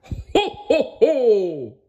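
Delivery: high cartoon jolly laugh; jolly Santa ho ho ho. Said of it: "a jolly laugh".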